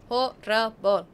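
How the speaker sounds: HORRIBLE in loud, separate beats; emphatic disgust. Real, not acted. Gamer condemning the game, Horrible said loudly. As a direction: punch each syllable of Horrible.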